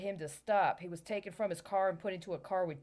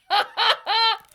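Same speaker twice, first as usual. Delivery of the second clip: sudden loud disbelieving laugh; loud burst of laughter; 16 dB louder than usual. Real, not acted. Incredulous laugh at the book.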